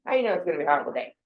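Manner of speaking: fast, pressing challenge; rapid-fire question. Shown as a demonstration. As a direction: rattle it off quickly. Repeats the question, rushing.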